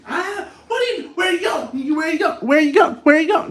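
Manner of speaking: high, loud shouts after someone leaving; panicked mock shouting; frantic calling out. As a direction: yell it after them, over and over. Acts partner chasing someone leaving.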